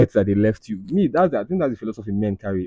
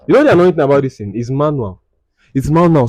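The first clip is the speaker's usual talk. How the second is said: annoyed rant; 5 semitones higher, 5 dB louder than usual. Real, not acted. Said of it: "This girl has killed me with this alarm".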